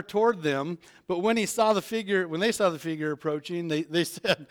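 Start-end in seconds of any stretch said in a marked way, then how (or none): none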